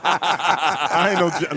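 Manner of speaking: high laugh, then slow, laughing words; laughing denial of Giants fandom. Real, not acted. Denying being a Giants fan.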